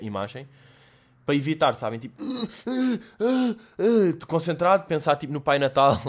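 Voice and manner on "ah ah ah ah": higher, gulping breaths, fighting tears; mock-gasp to keep from crying; holding back tears. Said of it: "Então faço aquele arregalar de olhos". (arquejos agudos a conter o choro)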